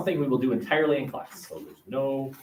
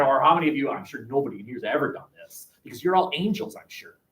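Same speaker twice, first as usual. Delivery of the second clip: usual speaking voice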